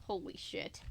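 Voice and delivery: softly breathed holy shit; whispered aside. Real, not acted. Reader stunned by the story, whispering. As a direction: mutter it under your breath in shock.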